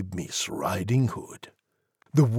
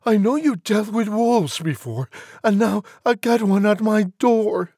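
high, squeaky pleading piglet; anxious high piggy voice; crying pig; 11 semitones higher, 5 dB louder than usual. A narrator's reading. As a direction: explain the trouble in a high pig wail. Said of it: "Pig cried".

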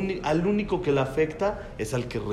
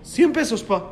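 son's reply, higher; voicing his son, who answers the price in a higher voice; 6 semitones higher than usual. Son telling his father the price, higher. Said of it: "Mi hijo grande dice".

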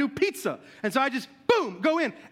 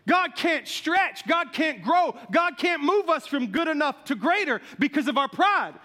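preaching; 4 semitones higher than usual. Acted. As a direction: drive the point home from the pulpit. Sermon point after the story.

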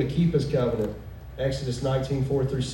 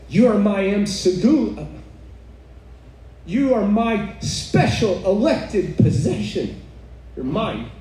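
higher, emphatic declaration in God's words; God speaking tenderly possessive; 4 semitones higher than usual. Acted. Preacher quotes God to the congregation, emphatic declaration. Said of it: "he is saying to us".

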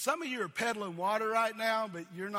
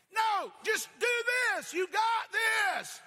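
encouraging shout; 13 semitones higher, 5 dB louder than usual. Pretend. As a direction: yell encouragement across the water. Coaching drowning swimmer.

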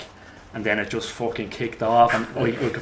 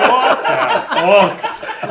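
a slow, laughing, higher "what the fuck"; laughing disbelief; 7 semitones higher, 6 dB louder, much slower than usual. Retold or imitated. Stunned player reacting to the chant, laughing in disbelief.

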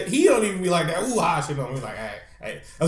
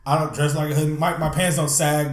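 usual speaking voice

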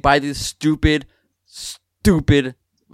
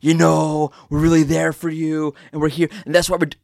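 mock sincere spiel; 2 semitones higher than usual. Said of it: "Don't give me, like, a whole, like, spiel".

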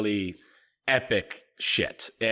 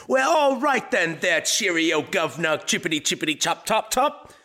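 hammed-up Cockney, pitched high; cheeky exaggerated London accent; mock British accent; 6 semitones higher than usual. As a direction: play a cartoon wolf in a British accent. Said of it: "long dock British kind of accent".

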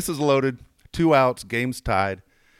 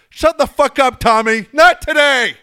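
loud, high-pitched angry yell; dugout shout; 13 semitones higher, 10 dB louder than usual. Retold or imitated. Angry teammate yells at Tommy from the dugout, shouting. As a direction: holler it full force at a teammate. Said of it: "you hear from the dugout".

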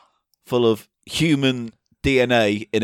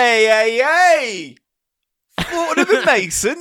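mock outrage; 9 semitones higher, 3 dB louder, slower than usual. Mock outrage at posh shop.

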